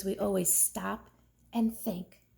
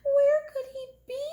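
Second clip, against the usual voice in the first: worried mother; 4 dB louder, faster than usual. Acted. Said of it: "His mother wept and wrung her hands".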